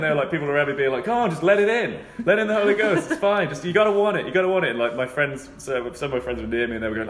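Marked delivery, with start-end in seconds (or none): 1.0-4.7 high, louder coaxing; hammed-up congregation coaxing; mock churchgoers. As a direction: coax it up high. Imitating churchgoers urging.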